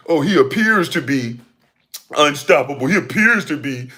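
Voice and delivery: put-on proper commentator voice, mocking; mock pundit innuendo. Ranter mimicking pundits and their innuendo, mock pundit voice. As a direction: mimic a pundit's affected, careful speech.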